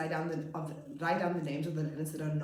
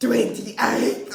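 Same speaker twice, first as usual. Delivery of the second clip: loud gasp then astonished, louder question; shocked kids; 6 semitones higher, 12 dB louder than usual. Retold or imitated. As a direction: gasp like children amazed by a big number. Kids shocked at teacher's age.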